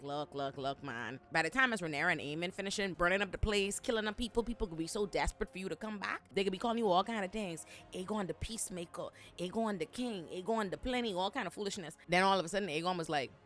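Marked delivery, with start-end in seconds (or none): 0.0-12.0 low, quiet, confiding scheming whisper-talk; hushed Larys voice. Retold or imitated. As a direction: lean in and warn him softly, lower than your own voice. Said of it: "And Larys is like".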